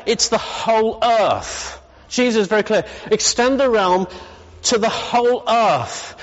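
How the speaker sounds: high, ringing sermon build; preaching. Acted. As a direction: preach it out to the whole room. Preaching at full force.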